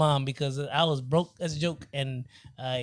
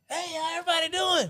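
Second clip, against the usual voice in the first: cheery call-out; 12 semitones higher than usual. Retold or imitated. Greeting strangers on subway.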